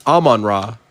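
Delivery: ordinary delivery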